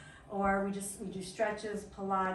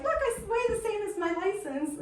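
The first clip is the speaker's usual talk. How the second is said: high and thrilled, showing off; excited quote; 11 semitones higher, 5 dB louder than usual. Retold or imitated. Katie showing off her weight loss, thrilled and high. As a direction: gush it out proudly. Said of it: "She's like".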